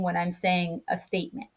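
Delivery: no rise at all on "statement"; level statement tone. Shown as a demonstration. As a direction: keep "when I'm saying a statement" on an even tone. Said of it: "you hear my tone stay the same".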